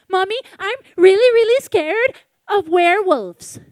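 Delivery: put-on high, loud little girl's voice; little girl telling mommy her fear, high-pitched; scared little girl. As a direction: sound like a frightened young child.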